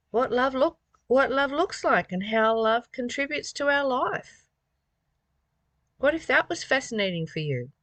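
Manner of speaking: ordinary talk, nothing marked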